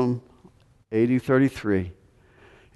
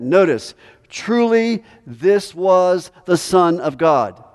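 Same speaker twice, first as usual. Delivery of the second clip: higher and louder, fiery sermon reading; preaching at full force; 7 semitones higher, 5 dB louder than usual. Acted. Preacher reads Matthew to the congregation, preaching at full force. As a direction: proclaim it to the back row.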